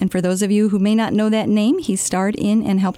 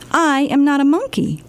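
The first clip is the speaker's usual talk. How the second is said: plain reading voice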